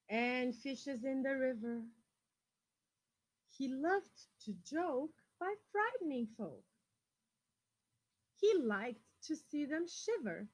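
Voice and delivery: put-on lively picture-book reading to children; storyteller voice for kids; story reading. A narrator's reading. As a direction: read it out to the class with expression. Reads a picture book aloud.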